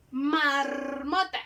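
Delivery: "Marmota" drawn out very slowly; slow word demo. Shown as a demonstration. Teacher giving pupils an R example slowly. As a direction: stretch "Marmota" syllable by syllable. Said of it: "Ese sería un ejemplo".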